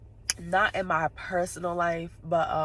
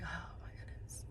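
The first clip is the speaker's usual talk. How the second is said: hushed aside to oneself, retold; barely audible whispered reaction; whispered exasperation; 19 dB quieter than usual. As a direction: breathe it out in a whisper. Retold reaction while waiting outside.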